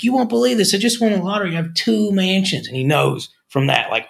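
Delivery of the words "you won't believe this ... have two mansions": excited neighbor. Retold or imitated. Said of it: "He's like".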